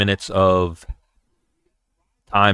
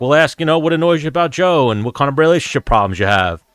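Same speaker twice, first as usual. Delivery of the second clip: higher-pitched, teasing self-quote; light and chatty, pitched up; joking retold pitch; 6 semitones higher than usual. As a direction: replay a breezy, joking suggestion. Retelling his joking comment.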